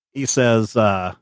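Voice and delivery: ordinary talk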